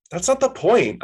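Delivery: high-pitched whiny protest; whining. A narrator's reading. Protesting to a friend on the phone, whining. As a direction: let a whine edge into it. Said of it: "a whine edging into my voice".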